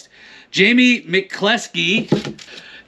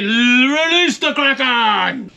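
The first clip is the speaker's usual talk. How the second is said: mock epic command; 4 semitones higher, slower than usual. Movie line called out.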